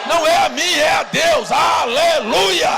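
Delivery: loud, high cry stretching into a long hallelujah; fervent preacher's shout. Real, not acted. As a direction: proclaim it at full force to the church. Climax of praise to congregation. (brado fervoroso de louvor)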